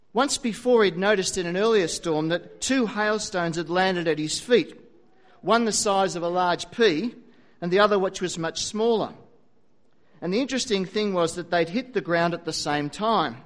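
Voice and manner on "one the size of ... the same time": ordinary talk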